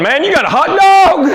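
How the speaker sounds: put-on a loud, high kid's voice: "Man, you got a hot dog?"; surprised buddy. Buddy at lunch, high loud surprised kid voice. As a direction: play the excited schoolboy at lunch. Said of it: "your buddy's going".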